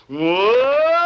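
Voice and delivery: long "Whoa" from a wide mouth; wide open whoa. Shown as a demonstration. Teacher models for the pupil, drawn-out open "Whoa". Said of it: "Now open your mouth wide and say".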